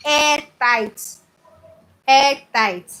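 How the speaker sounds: insisting on airtight; stressed airtight. Real, not acted. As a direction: bang out airtight each time. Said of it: "in whatever airtight storage container".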